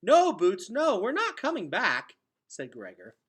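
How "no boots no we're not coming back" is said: urgent correction. Acted. Gregor correcting toddler.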